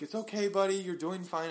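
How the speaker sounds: reassuring the dog. Retold or imitated. Owner soothing his sick dog in the car. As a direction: comfort a sick pet. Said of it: "I'm like".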